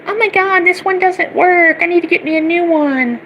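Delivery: mock a whining high school girl in a high squeak; whiny schoolgirl mock. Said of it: "like a whiny little high school girl".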